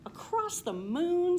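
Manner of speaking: high, loud, slow amazed cow; Buttercup the cow. Acted. Cow Buttercup marvels at the rhyme, high and drawn out. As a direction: stretch it out in wonder. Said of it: "said Buttercup".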